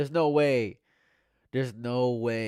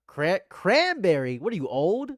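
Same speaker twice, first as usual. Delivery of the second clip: incredulous; 8 semitones higher, 6 dB louder than usual. Real, not acted. Reading next flavor, incredulous.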